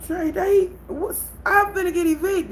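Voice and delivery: alarmed plea to her fiance; freaking out. Retold or imitated. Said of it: "freaking out".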